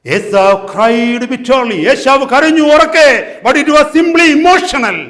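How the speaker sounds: loud, slow, high declaration; full-force preaching. Real, not acted. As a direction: proclaim it slowly from the pulpit.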